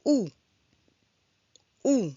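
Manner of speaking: "ou" with rounded lips, tongue drawn back; isolated "ou", mouth barely open; teacher modelling "ou" like English "you"; French OU sound. Shown as a demonstration. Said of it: "Bouche arrondie et un resserrement de la langue en arrière".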